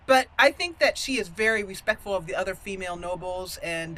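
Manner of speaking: ordinary delivery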